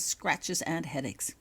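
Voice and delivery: plain reading voice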